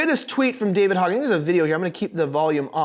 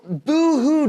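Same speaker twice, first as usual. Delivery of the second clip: higher-pitched mock pity; a drawn-out, taunting boo-hoo; 7 semitones higher, 4 dB louder than usual. Mocks the person being rebutted.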